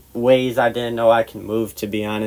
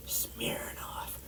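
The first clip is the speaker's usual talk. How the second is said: tiny secretive whisper; hushed one-word confession; whispered reveal; 20 dB quieter than usual. Retold or imitated. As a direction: breathe the name out almost silently. Whispered answer in retold exchange.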